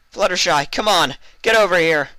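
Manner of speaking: high, loud urgent call; calling urgently. A narrator's reading. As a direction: call her to the doors. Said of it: "Vinyl said".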